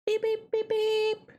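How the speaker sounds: mock beeping. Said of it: "imitated beep".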